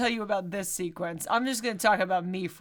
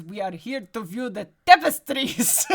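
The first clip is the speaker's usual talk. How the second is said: hammy fake Scottish brogue, a little louder; over-the-top Scottish accent; 4 dB louder than usual. Host imitating Indy's bluff at the castle, bad Scottish accent. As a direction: put on a terrible Scottish accent. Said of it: "ridiculous over-the-top Scottish accent".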